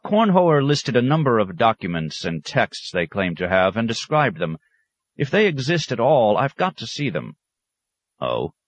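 a proud scholar pressing his point; keen academic pressing the monsignor; scholar's voice. Acted. Scholar Thon Taddeo speaking.

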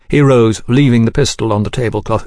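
ordinary delivery, nothing marked

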